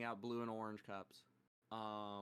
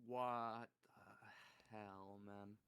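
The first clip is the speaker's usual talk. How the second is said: very slow, softening to the end; stunned disbelief; 3 semitones lower, 6 dB quieter, much slower than usual. Pretend. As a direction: stretch it out, dumbfounded. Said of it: "I'm gonna be like".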